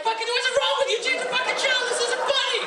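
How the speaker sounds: very high, loud furious scolding; hammed-up hysterical outrage; outraged mock shrieking. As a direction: scream it like a scandalised family. Mother and sister yelling at him.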